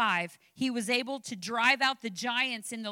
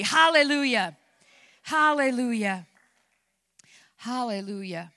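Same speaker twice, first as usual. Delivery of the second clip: fading praise; 7 dB louder than usual. Real, not acted. Closing praise after climax.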